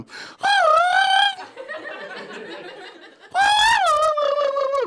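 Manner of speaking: big shrieking laugh, high and loud; loud burst of laughter. Real, not acted. Laughing loud at his own story. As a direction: crack up hard at the memory.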